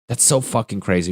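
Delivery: ordinary talk, nothing marked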